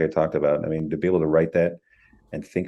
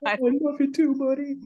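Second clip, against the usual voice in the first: high character voice. Retold or imitated. Reply in character voice.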